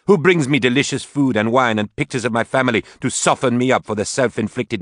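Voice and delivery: loud, high, bitter accusation; shouting. Acted. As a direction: hurl it at her daughter. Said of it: "She was now shouting".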